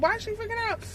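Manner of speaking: high puzzled phone voice, a light imitation; a light imitation of a confused caller; lady on the phone. Lady on the phone asks why she's upset, high and puzzled.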